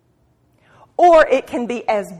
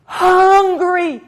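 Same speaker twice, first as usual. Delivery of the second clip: hungry cry; 8 semitones higher, 7 dB louder than usual. Retold or imitated. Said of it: "everything in me goes".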